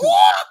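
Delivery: very high, loud yelled roar; roaring shove. Acted. Showing how the guy pushed Ellis back, high yelled roar. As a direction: burst out with a sudden shoving yell. Acts out man shoving Ellis again.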